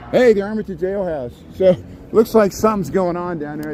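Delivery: ordinary talk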